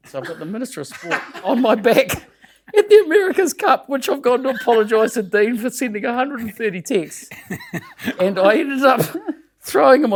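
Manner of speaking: can barely get it out for laughing. Real, not acted. Laughing while telling wild story.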